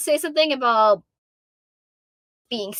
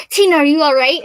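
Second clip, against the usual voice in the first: put-on worried boyfriend; 4 semitones higher, 7 dB louder than usual. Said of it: "him being like".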